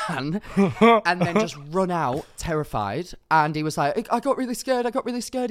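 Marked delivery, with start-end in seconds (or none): 4.1-5.5 higher mock frightened whimper; imitating the scared drunk guy. Drunk guy voiced after fright.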